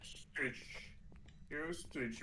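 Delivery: mock Chinese accent, half-whispered; chinese accent russian. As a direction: fake a Chinese accent, quietly. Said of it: "с китайским акцентом".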